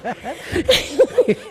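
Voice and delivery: short amused laugh. Real, not acted. Laughs at own jab.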